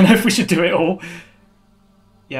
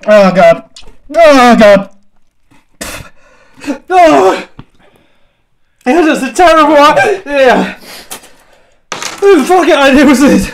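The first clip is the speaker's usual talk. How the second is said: loud, high groaning in pain; moaning through burning pain, close to tears; loud groans of distress; real agony; 7 semitones higher, 8 dB louder than usual. Real, not acted. Suffering from the sauce.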